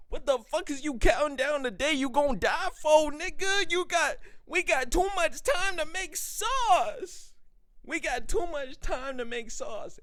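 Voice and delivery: loud, high, slow preaching; fired-up rant. Real, not acted. Vlogger lecturing pessimistic viewers, loud and high. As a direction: preach at the doomsayers.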